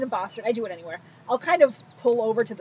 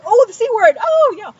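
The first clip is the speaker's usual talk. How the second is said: loud, high-pitched exaggerated reaction; hammed-up scandalized exclamation; mock shock; 10 semitones higher, 9 dB louder than usual. Teller replaying her reaction to a stranger's swearing, mock shock. Retold reaction to being sworn at.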